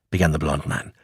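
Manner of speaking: plain reading voice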